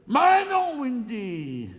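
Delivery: high, loud "mais non" at Wendy; a sudden exasperated shout at the partner; exasperated outburst. Acted. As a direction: cry out in comic exasperation.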